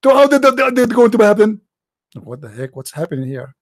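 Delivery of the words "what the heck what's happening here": muttering. Real, not acted. Exasperated aside.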